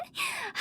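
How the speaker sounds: a quick high playful giggle; teasing giggle. Acted. Hu Tao giggles at Paimon after teasing her, a quick high giggle. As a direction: giggle at your own joke.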